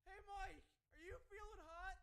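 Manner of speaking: high, loud mock yell; high-pitched retold holler; imitated yelling. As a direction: call out like a delusional tentmate. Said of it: "he starts yelling".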